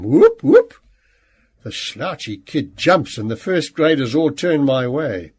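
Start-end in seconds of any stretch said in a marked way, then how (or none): 0.0-0.7 two whoops sweeping very high and loud; fire alarm whoop. A narrator's reading. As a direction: wail like a siren twice. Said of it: "a high, rising sound like a fire alarm".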